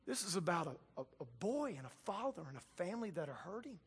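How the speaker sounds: hushed, tender reminder; Jesus telling the crowd a family is hurting, hushed; gentle quiet Jesus. Retold or imitated. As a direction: soften right down, full of compassion. Jesus voiced, compassion for family.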